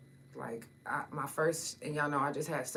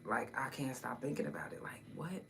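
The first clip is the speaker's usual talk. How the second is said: hushed, breathy whisper; 3 semitones lower, 8 dB quieter than usual. Real, not acted. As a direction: whisper it, shaken. Haunted by the memory.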